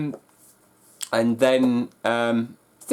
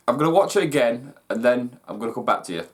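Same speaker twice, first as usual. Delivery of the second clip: normal talking voice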